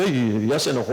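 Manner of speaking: low, deflated imitation; mock dismay. Preacher mimics believers who think God got angry, low dismay. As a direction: sink down low as if scolded.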